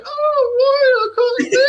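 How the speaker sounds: high, loud swooning elf woman; high wailing admirer. Acted. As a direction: cry out to the warrior, overcome.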